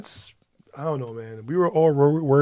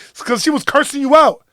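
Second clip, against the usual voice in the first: higher and louder, incredulous; exasperated loud; 11 semitones higher, 9 dB louder than usual. Retold or imitated. Husband explaining to his oblivious wife, loud and exasperated. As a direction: spell it out to her, exasperated. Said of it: "And I'm like".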